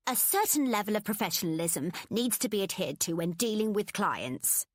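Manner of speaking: prim, scolding manager voice; stern boss. A narrator's reading. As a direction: lecture them like a strict boss.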